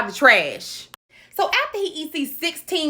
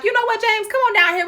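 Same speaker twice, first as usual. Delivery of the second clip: imagined kindly aunts; 10 semitones higher than usual. Retold or imitated. Aunts voiced as hoped-for kindness.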